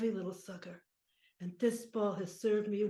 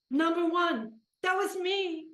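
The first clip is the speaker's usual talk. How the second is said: loud, high and proud; proud boast; 7 semitones higher, 6 dB louder than usual. Acted. Old pitcher recalls being number one, proud boast. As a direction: proclaim it like a past champion. Player boasts of past glory.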